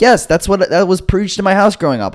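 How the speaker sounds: high-pitched, earnest self-defence; indignant insistence. Retold or imitated. Defends his dad's advice to friends.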